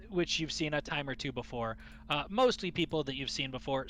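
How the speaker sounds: usual speaking voice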